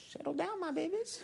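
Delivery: giggling high coo. Real, not acted. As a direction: coo at the crowd like babies.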